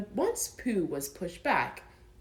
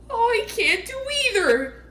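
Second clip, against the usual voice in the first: high, louder helpless bear voice; despairing Pooh; 8 dB louder than usual. Acted. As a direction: wail that you're trapped both ways.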